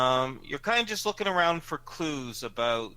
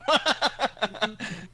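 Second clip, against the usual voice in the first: laughing; 8 semitones higher, 7 dB louder than usual. Real, not acted. GM cracks up at mirror.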